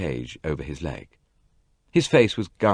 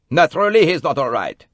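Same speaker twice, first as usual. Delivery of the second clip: louder, mildly reproaching doctor; reproachful; 10 semitones higher, 7 dB louder than usual. A narrator's reading. Bessner answering Jackie, in a reproachful doctor's voice. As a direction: scold her a little. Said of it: "said Bessner reproachfully".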